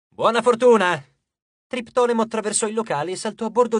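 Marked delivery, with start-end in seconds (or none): 0.1-1.0 sarcastic. A narrator's reading. Nico mocks the god's advice.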